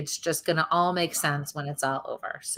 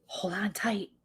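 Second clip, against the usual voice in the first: urgent hushed whisper, softer; 3 semitones higher, 5 dB quieter, slower than usual. Retold or imitated. Medium passing on the mother's message in a whisper. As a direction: whisper it like a secret plea. Medium relays spirit's words.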